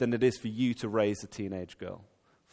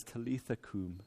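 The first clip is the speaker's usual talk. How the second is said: put-on soft, hushed command to a child; tender; 3 semitones lower, 11 dB quieter than usual. Jesus speaking softly to the little girl, tender and hushed. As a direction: murmur it softly like waking a child. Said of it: "wonderfully tender".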